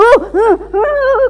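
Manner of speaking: loud, high exasperated "uh" bursts; frustrated grunts. Retold or imitated. Preacher acting out stabbing the diary, frustrated grunts. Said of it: "kesel gitu".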